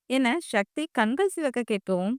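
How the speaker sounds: usual speaking voice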